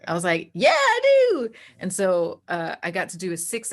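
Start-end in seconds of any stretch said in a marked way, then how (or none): 0.5-1.5 a high, loud, thrilled yes; eager yes. Retold or imitated. Accepting the producer's offer to GM, thrilled. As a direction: jump at the offer.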